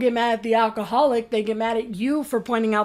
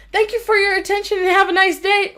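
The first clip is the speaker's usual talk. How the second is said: high, loud, put-on politeness; mock cheery; 9 semitones higher, 5 dB louder than usual. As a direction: mimic a sugary official sign-off, high-pitched. Mocks the post's polite sign-off.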